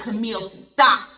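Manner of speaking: loud, slow firm stop; loud slow firm self command. Retold or imitated. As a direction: order herself to stop. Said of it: "I said".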